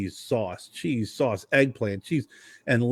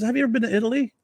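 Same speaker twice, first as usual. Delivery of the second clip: high incredulous challenge; Italian guy; 12 semitones higher, much faster than usual. Retold or imitated. Winery guy challenging the speaker about Italy, high. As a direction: ask it up high, a bit scornful. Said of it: "He goes".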